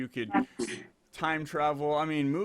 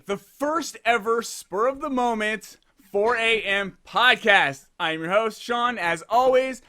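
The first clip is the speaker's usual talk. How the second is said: raised, punchy announcer delivery; podcast host intro; 7 semitones higher, 5 dB louder than usual. Real, not acted. Host opening the episode to listeners, big announcer intro. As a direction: hype up the show, stretching key words.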